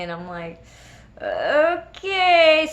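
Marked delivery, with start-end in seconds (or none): 1.2-2.7 high, loud, drawn-out mock-nervous okay; reluctant agreement. Nervous jumper giving in to her insistent friend, reluctant agreement. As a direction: give in with exaggerated dread.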